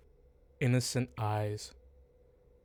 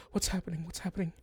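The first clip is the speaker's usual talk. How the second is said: higher, fearful repeat; scared child; 7 semitones higher, much faster than usual. Acted. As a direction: ask it twice in rising fear. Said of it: "she goes".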